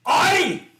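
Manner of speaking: loud, high Scottish "Aye!". Retold or imitated. Kid proudly confirming he's the musician, loud Scottish aye. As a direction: bark "Aye!" in a Scottish accent. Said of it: "'Cause that's what Scots say".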